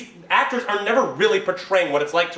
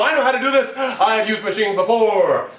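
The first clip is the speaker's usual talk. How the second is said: higher, louder, cocky boast; cocky tech lover; 4 semitones higher, 6 dB louder than usual. Retold or imitated. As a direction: brag like you know every machine. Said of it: "Techno Joy".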